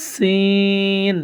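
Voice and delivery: সিন with a long hissing s, held out; long breathy Arabic sin; airy sin. Shown as a demonstration. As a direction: let the air stream through সিন. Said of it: "একটু বাতাসের মতো বের হবে".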